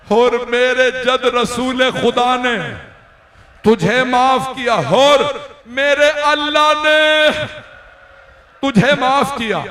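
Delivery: building to a high, loud, slow climax; rising impassioned cry. Acted. Husain forgives Hur, climactic refrain. (हर बार ऊँची होती, धीमी, ज़ोरदार पुकार)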